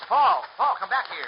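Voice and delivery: loud, high call after him; Perry yells after Paul heading down the path. Acted. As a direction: shout to stop him from running off. Perry calls after Paul running off.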